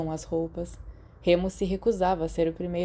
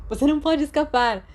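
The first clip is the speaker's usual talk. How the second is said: high, loud playful crowing; gleeful, like a kid who won; playful triumph; 8 semitones higher, 5 dB louder than usual. Acted. Sirius holding Remus down, crowing with glee. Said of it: "Sirius disse".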